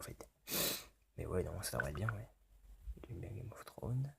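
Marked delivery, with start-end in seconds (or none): 3.1-4.1 soft secret whisper. Pretend. Player reading the dating option, hushed whisper. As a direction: murmur it as an aside.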